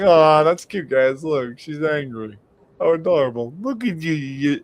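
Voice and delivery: retold patronising baby talk; mock adoring. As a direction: fawn over her as if she were a cute pet. Cooing at angry girlfriend to tease.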